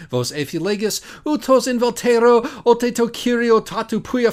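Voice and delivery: loud, high, grand recital of the Greek text; booming mock-scholarly Greek recitation; declaimed ancient Greek. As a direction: orate the Greek like a stage classicist. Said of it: "Plato says in section three eighty-two A and B".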